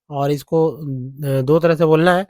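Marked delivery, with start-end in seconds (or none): none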